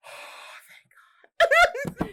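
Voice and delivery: breathy then high crying thank God; hammed-up relief; relieved. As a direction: sob with relief. Relief at getting home.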